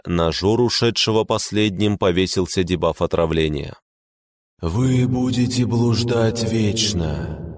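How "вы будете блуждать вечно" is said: slow eerie spirit whisper; barely audible whisper. A narrator's reading. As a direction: whisper it slowly, like fog itself. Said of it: "еле слышный шёпот сразу со всех сторон".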